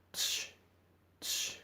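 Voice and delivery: soft tsh repeated twice; demo consonant sound. Shown as a demonstration. Teacher demonstrating the double C sound, soft. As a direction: hiss out tsh twice, softly. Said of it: "aumenta un sonido".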